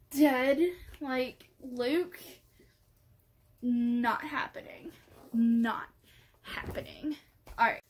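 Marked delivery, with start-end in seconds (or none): 5.3-7.2 hushed, final refusal; whispered denial. Real, not acted. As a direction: whisper it as a flat no.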